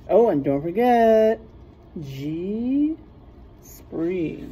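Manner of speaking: ordinary delivery, nothing marked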